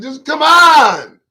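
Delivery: rising, drawn-out exasperated yell; exasperated shout. Real, not acted. Host fed up after listing killings, exasperated shout. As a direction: stretch it into a loud, high plea. Exasperated rant about people getting killed.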